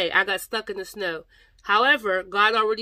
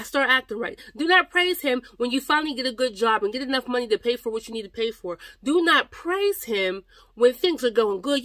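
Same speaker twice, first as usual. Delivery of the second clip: higher, louder exhortation; impassioned preaching; 4 semitones higher, 5 dB louder than usual. Real, not acted. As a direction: drive each "praise him" home with rising fervour. Exhorts listeners at full force.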